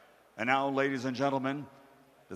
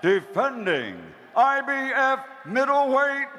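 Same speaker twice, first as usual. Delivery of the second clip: building, stretched fighter introduction; ring announcer intro; 10 semitones higher, 7 dB louder than usual. Acted. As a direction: build to a roaring finish.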